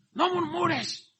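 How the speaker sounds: high, scolding no murmures; mock scold. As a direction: mimic the nagging critic. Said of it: "te quieren parar".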